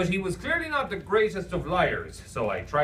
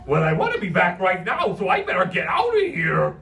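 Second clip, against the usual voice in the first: loud goofy cartoon urgency; urgent cry; 3 semitones higher, 5 dB louder than usual. A narrator's reading. Imaginary friend hurries off on his errand, urgent cry. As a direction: rush off crying out like a clown. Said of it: "he cried with some urgency".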